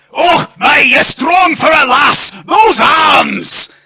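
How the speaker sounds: booming, high-pitched Scottish lord; broad Scottish accent. Acted. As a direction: bellow it in a hammed-up Scottish accent. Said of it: "I apologise in advance for this accent".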